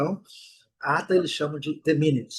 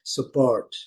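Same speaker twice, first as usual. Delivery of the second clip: "support" stressed on "port"; stress on second syllable; 3 semitones lower than usual. Shown as a demonstration. Teacher demonstrating the verb "support", second syllable strong. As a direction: lean on "port" in "support", slowly. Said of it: "a segunda sílaba que é a forte".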